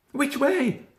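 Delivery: very high, loud, breathless rabbit pleading; a small rabbit begging for help, voice way up; frantic Benjamin. A narrator's reading. Benjamin begging Peter for news, high frantic voice.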